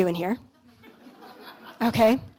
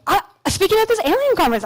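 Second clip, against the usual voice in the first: fast; 7 semitones higher, much faster than usual. Retold or imitated. Retelling her hurried reply.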